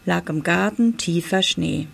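usual speaking voice

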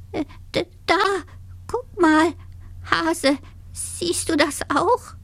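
high, halting, stammering squeak; frightened. A narrator's reading. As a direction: stutter timidly, word by word. Terrified dormouse points out monster.

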